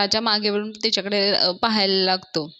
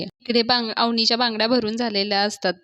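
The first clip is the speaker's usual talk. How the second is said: ordinary talk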